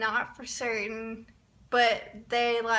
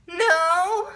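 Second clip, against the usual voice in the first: high, loud mock wail; hammed-up distressed no; overwhelmed panic; 6 semitones higher, 6 dB louder than usual. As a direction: whine it like a fan falling apart. Acts out not being okay.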